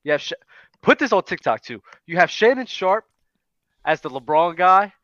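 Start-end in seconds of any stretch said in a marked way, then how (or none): none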